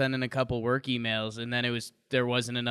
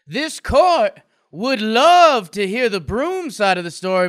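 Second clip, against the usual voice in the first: loud, high southern courtroom oratory; southern accent; 10 semitones higher, 12 dB louder than usual. Acted. Lawyer mock-addressing a court about a broken broom, southern accent. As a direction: proclaim it in a big southern drawl. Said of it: "a southern accent".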